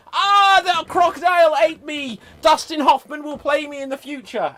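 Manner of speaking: loud, high pantomime wail; hammed-up death cry. Villain eaten by a crocodile, hammed-up death cry. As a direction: cry out as a doomed villain, very over the top.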